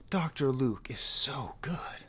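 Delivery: a slow, voiceless confessional whisper; whispered guilty admission. Real, not acted. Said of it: "But he's a bad person".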